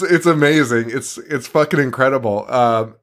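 ordinary delivery

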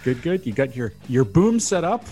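ordinary delivery

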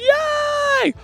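very high, drawn-out cheer; crowd cheer. A narrator's reading. Platypuses cheering their champ, high-pitched cheer. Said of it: "All the platypuses cheered".

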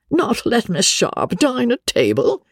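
put-on high, indignant old lady's voice; a rich old spinster scoffing. Old lady to Sir Pitt, indignant and high.

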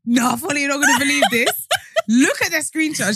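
high, giggling retold sister; sister laughing. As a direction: quote her while cracking up. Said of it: "she said".

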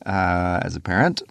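ordinary talk, nothing marked

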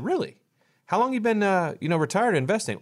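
high, rising incredulous really; mock surprise. Host questioning a worried retiree, mock surprised. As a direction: feign disbelief to lead him on. Host quizzing anxious investor.

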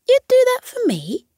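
very high, amazed squirrel; touched surprise. Acted. Squirrel amazed the fox would change his sleep for him. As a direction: squeak it up in disbelief.

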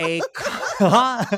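loud, high burst through laughter; cracking up. Real, not acted. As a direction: lose it laughing mid-word. Cracks up restarting the plot summary.

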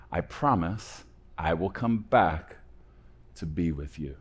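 soft, slow, reassuring promise; gentle parent. Acted. Parent promising a child to come back, gently reassuring. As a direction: explain it softly and slowly, with pauses.

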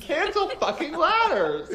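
high, slow, laugh-cracked declaration; laughing mock rant. Riffing with the hosts, laughing mock outrage. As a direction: fake fury dissolving into laughter.

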